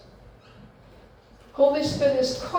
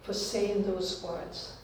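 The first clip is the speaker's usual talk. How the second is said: hushed, humble apology; soft apology; 3 semitones lower, 10 dB quieter than usual. Retold or imitated. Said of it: "phone this relative and say".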